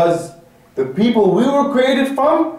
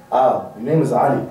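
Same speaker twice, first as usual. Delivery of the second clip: low, hesitant mock answer; timid introduction; 9 semitones lower than usual. Preacher mimics shy brother giving his name, timid. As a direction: act out an unsure, sheepish reply. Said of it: "And say it with pride".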